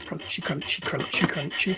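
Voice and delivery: breathy whisper, playful sound; whispered crunchy. Pretend. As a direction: whisper it like a grinding wheel. Engine grinding over track.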